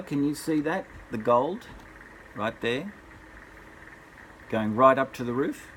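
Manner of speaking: plain reading voice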